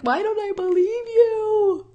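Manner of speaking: high, tearful, slow plea; crying out. Retold or imitated. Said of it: "And I'm like".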